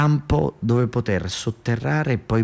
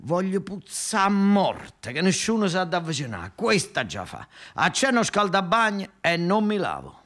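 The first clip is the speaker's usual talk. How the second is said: mock rubbish character; 9 semitones higher than usual. Monnezza character boasting in song-like voice.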